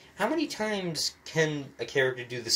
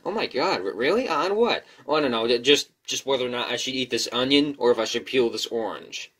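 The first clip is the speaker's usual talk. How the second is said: acted alternative dialogue; 3 semitones higher, 7 dB louder than usual. Shown as a demonstration. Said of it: "here's an alternative".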